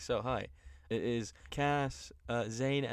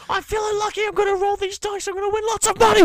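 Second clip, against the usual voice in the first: very high, loud frenzied commentary; hammed-up ecstatic screaming, building louder; Michael Cole; 11 dB louder, faster than usual. Host mimicking commentator going crazy about Las Vegas, overblown excitement. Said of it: "Michael Cole has a fucking orgasm".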